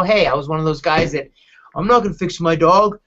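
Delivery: ordinary talk, nothing marked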